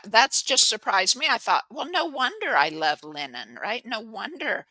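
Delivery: ordinary delivery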